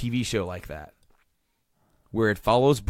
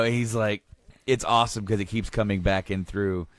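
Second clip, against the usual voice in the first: usual speaking voice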